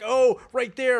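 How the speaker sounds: high, loud imitated cries of pleasure; mock screaming in bed. Retelling a one night stand, mock loud high cries. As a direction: ham up her loud cries. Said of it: "she's like screaming".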